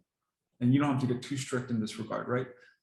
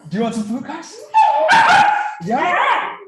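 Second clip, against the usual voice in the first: much louder and higher, rising excited offer; hyped food call; 13 dB louder than usual. Shown as a demonstration. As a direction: pump the dogs up before dinner. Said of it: "maybe I'll give you an example".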